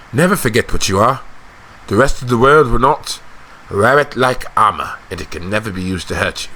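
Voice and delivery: confiding storyteller with thoughtful pauses; wry, worldly noble. Acted. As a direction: recount an old wound with restraint. Acting a confiding character.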